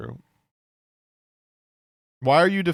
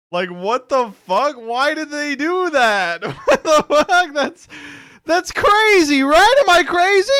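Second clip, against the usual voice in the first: incredulous; 11 semitones higher, 7 dB louder than usual. Real, not acted. Ranting in disbelief at the video.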